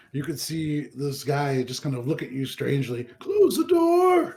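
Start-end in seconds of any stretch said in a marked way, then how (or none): 3.2-4.3 high, loud, drawn-out yell; yelled order. Acted. Guy yelling at the departing player, long high shout. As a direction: holler it long and high after someone leaving. Game master voices man yelling after player.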